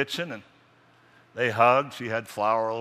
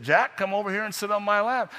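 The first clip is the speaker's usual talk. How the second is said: insistent grandma; 8 semitones higher, 3 dB louder than usual. Acted.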